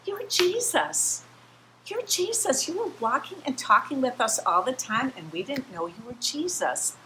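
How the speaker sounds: high, excited disbelief; astonished excitement. Retold or imitated. Two men realize the stranger is Jesus, high and excited. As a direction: gasp it out as the men recognize him. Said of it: "They're like".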